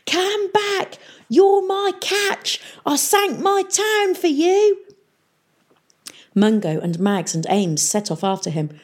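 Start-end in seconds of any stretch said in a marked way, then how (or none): 0.1-4.8 high, drawn-out desperate yell; screaming. A narrator's reading. Peavy chasing the rising town, screaming desperately. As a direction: scream high after something escaping. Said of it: "they heard him screaming".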